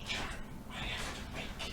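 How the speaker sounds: hushed, voiceless whisper in character; very soft acted whisper. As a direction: whisper it under your breath. Game master voices a whispering character.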